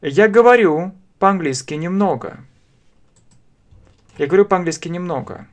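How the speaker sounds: ordinary talk, nothing marked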